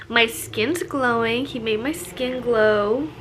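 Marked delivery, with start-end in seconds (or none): none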